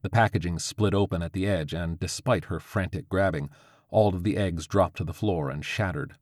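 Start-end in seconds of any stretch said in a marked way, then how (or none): none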